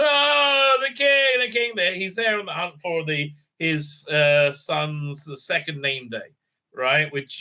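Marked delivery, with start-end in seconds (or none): 0.0-1.7 excited crowd cheer. Retold or imitated. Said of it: "everybody claps".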